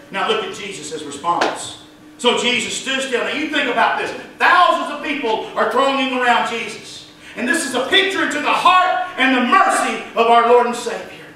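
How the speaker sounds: usual speaking voice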